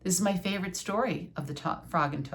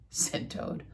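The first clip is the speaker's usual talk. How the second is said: ordinary delivery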